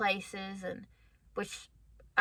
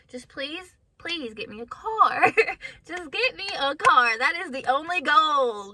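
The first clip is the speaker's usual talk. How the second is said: higher, louder begging with a laugh; mock pleading; 5 semitones higher, 7 dB louder than usual. Praying to God for a car, insistent playful pleading. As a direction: plead harder, building louder. Acting out her prayer for a car.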